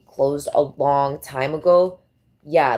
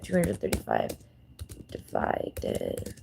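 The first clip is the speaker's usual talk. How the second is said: muttered to self; 10 dB quieter, slower than usual. Real, not acted.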